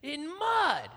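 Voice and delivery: rising to a shout on the last words; wife covered in mud yelling at husband; furious shout. Retold or imitated. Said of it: "She goes".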